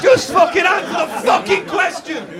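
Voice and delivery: high, sweary outburst; exasperated shout. Real, not acted. Host to stalling contestant on blind date, exasperated shout.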